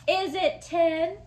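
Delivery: loud, slow game-show question; dramatic question. Acted. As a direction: pose it like a quiz host.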